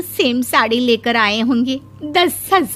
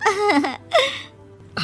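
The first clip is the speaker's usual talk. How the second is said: bursts into a crying-like laugh; high, tearful cackle of mock pride; 8 semitones higher than usual. Mother laughs at her own praise of daughter, high laugh.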